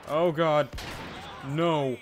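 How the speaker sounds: loud dismayed cry; loud groan. Real, not acted.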